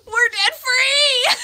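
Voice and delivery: real joyful scream at full force, high and loud; debt-free scream. Real, not acted. Shouting to the debt company over the phone that she's finally debt free, an ecstatic scream. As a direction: scream the news down the phone, slowly.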